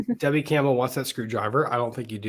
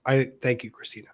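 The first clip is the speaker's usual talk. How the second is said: ordinary delivery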